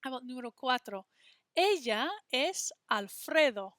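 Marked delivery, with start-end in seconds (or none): none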